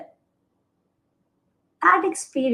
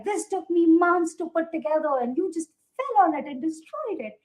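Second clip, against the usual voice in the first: higher-pitched mock scolding; retold anger; 6 semitones higher than usual. Grandfather scolding over his tomato plant, mock mad. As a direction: imitate an exasperated grandfather's complaint. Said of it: "he was so mad".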